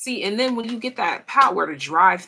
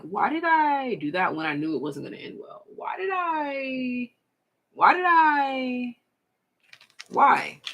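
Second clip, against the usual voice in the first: exasperated self-questioning; 6 semitones higher than usual. Retold or imitated. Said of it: "you might really find yourself like".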